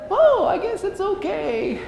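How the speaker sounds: mock-innocent jumper voice; clueless jumper. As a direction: play the cheerful fool who has no idea. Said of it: "He looks up and says".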